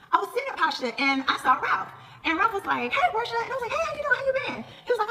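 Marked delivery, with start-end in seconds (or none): 2.9-3.3 high, bright-voiced mock hello; imitating Ralph's greeting. Ralph greeting Portia at Pasha, high mock hello. 3.7-4.6 high sing-song hello, much higher; mock sweet greeting. Portia greeting Ralph at Pasha, high sugary hello.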